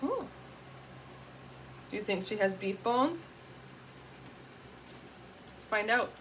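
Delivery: ordinary delivery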